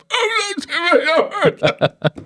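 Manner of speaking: very high, strangled mock groan; hammed-up breathless squeal of pain; imitated winded wail. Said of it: "the super wind knocked out of him".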